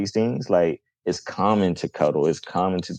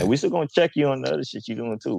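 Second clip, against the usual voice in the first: youthful tough talk; 7 semitones higher, much faster than usual. Retold or imitated. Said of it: "that's how we would talk to each other back then".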